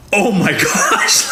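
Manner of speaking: high, slow, laughing gasp of horror; laughing shock. Retold or imitated. Train driver after ramming golf cart, high laughing horror. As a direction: stretch it out through shocked laughter. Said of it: "I was like".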